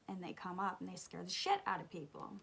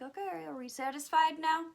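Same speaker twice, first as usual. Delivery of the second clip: higher, louder sarcastic jab; 8 semitones higher, 7 dB louder than usual. Retold or imitated. Annoyed girl confronting friends back at car, sarcastic. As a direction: throw it at them with irritation. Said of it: "I was like".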